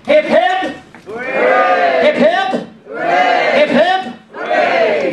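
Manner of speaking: high call for hip hip hooray; celebratory cheer. Acted. Son leading cheers for himself, mock-excited high cheer.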